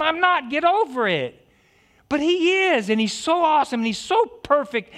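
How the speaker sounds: high fervent preacher voice; preaching. Acted. Pastor exalting God to his church, high and fervent. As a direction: proclaim it, lifting each line.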